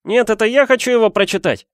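put-on high, heated boyish protest; Harry, angry exclamation. A narrator's reading. Boy claiming his own letter, high and angry. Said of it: "гневно воскликнул Гарри".

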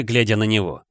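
ordinary delivery

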